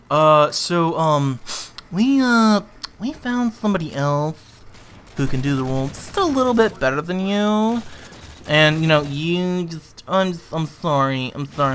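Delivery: awkward rejection. Retold or imitated. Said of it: "email saying".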